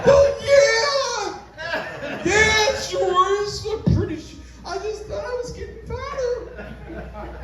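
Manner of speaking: high, laughing mock shout; hammed-up yell.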